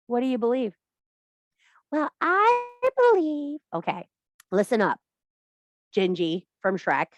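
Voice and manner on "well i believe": prissy mock girl voice. Mocking a young witch.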